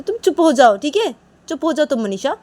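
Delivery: loud, high-pitched telling-off; irritated command; cross order. Acted. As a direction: shut her up firmly. Ordering Manisha to be quiet.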